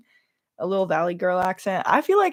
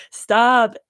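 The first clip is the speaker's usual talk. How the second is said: mock stern stop; 4 semitones higher, 6 dB louder than usual. Telling viewers again to quit it, firm mock stern. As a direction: stop landed firmly, a touch louder.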